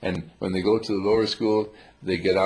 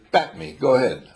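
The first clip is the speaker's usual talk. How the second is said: eager playful quote; 5 semitones higher, 6 dB louder than usual. Retold or imitated. Playful toy speaking in story.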